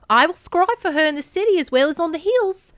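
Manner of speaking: put-on high, loud Australian-accented goddess. A narrator's reading. Hera offers to scry for Kor, Australian accent. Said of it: "gave Hera an Australian accent".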